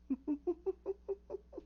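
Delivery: soft, very high stuttering giggle; imitated cackle. Laugh heard as he sits back, imitated high cackle. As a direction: imitate a creepy high laugh, kept quiet. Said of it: "that's when you hear".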